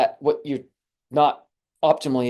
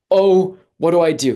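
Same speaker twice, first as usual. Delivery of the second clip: higher, louder, a playful fake alarm; mock panic; 5 semitones higher, 7 dB louder than usual. Imagining a burning knee, mock panicked cry. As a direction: cry out like your knee's on fire. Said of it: "and we're like".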